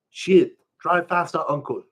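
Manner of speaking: put-on high, loud pidgin kid urging; urgent kid. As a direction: hurry up, uncle.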